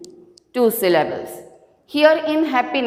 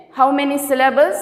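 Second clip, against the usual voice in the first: normal talking voice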